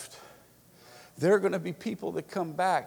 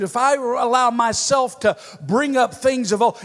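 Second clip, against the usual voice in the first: loud, raised, driving revival delivery; full-force preaching; 8 semitones higher, 9 dB louder than usual. Real, not acted. Preacher urges the church toward unity and revival, loud and fervent. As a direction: press on phrase after phrase, loud and urgent.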